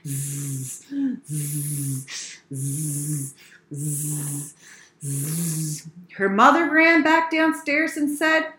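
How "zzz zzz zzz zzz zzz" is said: low buzzing pretend snore; storybook buzzing snores; snoring. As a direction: drone a low, soft zzz of sleep. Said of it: "went to sleep on the floor".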